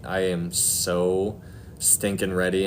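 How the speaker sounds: normal talking voice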